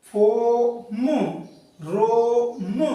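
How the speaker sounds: फोम and रोम drawn out slowly, long ओ; slow demo reading. Shown as a demonstration. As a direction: stretch each word for the class. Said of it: "ओ के बाद ए". (फोम, रोम धीरे खींचकर)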